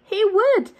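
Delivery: high, loud "He would"; a kid stressing that yes, he really would; emphatic insistence. Acted. Emphatic aside in story.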